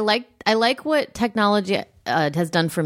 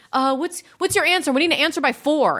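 raised, insistent "We need an answer"; pushy demand; 7 semitones higher than usual. Retold or imitated. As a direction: mimic pushy emailers wanting answers now. Demanding emailers quoted.

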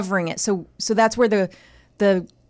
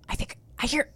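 hushed, unvoiced words; whispering; 6 semitones higher, 9 dB quieter than usual. Wife hearing the lost cat, whispering to her husband. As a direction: whisper it so the cat isn't scared. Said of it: "and I go".

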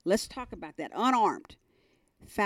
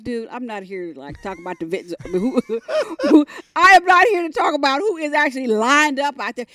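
exasperated; 7 semitones higher, 10 dB louder than usual. Retold or imitated. Retells exasperation at her brother.

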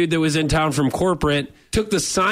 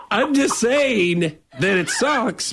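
slow, laughing, pitched up; stifled laugh; 6 semitones higher, much slower than usual. Real, not acted. Prankster fighting laughter to sell the fake firing, slow laugh. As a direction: hold back a laugh and fail.

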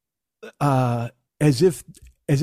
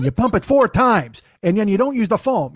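loud garage bartender; 8 semitones higher, 5 dB louder than usual. Retold or imitated. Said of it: "some dude in his garage who's going".

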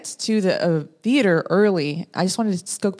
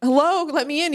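calling out; 8 semitones higher than usual. Retold or imitated. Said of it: "I start knocking".

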